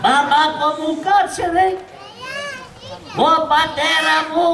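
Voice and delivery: high-pitched fake sobbing wail; overdone child mourning his daddy; mock lament. Son fakes wailing for father. (ψεύτικο παιδικό μοιρολόι)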